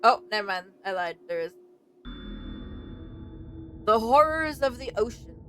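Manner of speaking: usual speaking voice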